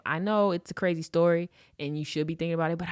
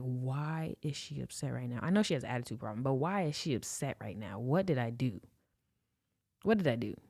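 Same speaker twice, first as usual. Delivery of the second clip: lower, hushed bewilderment; baffled inner voice; 4 semitones lower, 6 dB quieter than usual. Retold or imitated. Man thrown by her being upset, baffled. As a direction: think aloud, low and puzzled.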